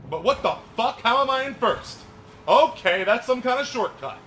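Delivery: high, loud, stunned outburst; can't believe you're in first; loud astonished yelling; shocked disbelief. Real, not acted.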